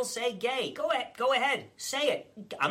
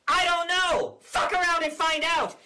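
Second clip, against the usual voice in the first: mock shout; 6 semitones higher, 3 dB louder than usual. Governor yells at critics.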